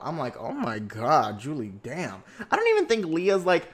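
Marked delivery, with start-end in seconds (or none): 0.4-2.2 slow shocked drawl. Retold or imitated. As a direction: let the shock sink in slowly. Reacting to Julie's harsh drag.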